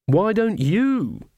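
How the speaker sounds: high, drawn-out nasty comeback; nasty retort. A narrator's reading. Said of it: "as nastily as she could manage".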